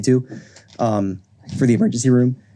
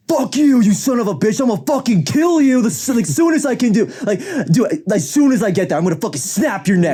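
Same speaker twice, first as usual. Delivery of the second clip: imitated angry yelling; 10 semitones higher than usual. Storyteller mimics raging patient threatening classmate, mock yelling. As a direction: act out a patient's threatening rant. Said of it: "he's fucking yelling at my".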